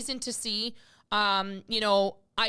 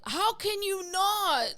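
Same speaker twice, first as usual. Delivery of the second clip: incredulous; 7 semitones higher, slower than usual. Real, not acted. Outraged at being told to stay silent.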